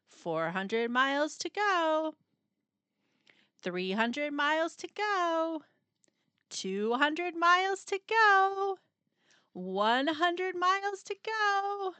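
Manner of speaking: building countdown. A narrator's reading. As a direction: build the tension toward the finish.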